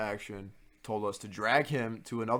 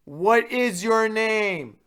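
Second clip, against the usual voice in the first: loud, high, very slow demand, a bit strained; imitating RA yelling slowly at drunk student; mock RA shout; 12 semitones higher, 11 dB louder, much slower than usual. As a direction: repeat it even louder and slower.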